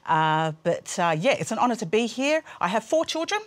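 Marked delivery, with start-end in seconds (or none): none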